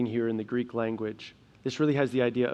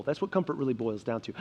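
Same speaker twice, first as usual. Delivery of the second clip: ordinary talk, nothing marked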